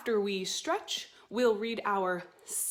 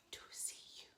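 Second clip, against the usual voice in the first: soft whispered echo of the line; 21 dB quieter, much faster than usual. Shown as a demonstration. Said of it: "like an echo".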